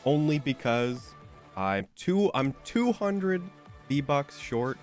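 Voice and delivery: usual speaking voice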